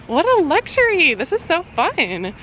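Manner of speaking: high bright exclaim of delight. Real, not acted. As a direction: gush over the little treat. Delight at a TV night.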